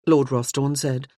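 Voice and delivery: ordinary delivery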